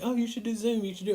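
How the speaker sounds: Liz. Retold or imitated. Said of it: "my wife Liz has been saying".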